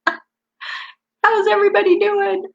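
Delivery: squeaky high, slow sing-song; laughing, stretched falsetto check-in; high silly repeat. Pretend. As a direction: redo the greeting in a silly high voice.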